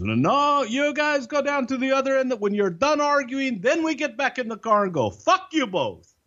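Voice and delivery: high, put-on fed-up driver voice; a weary friend waving the two off, pitched high; imitating the driver. Driver at the bar telling the two arguers to go away, in a high mock voice.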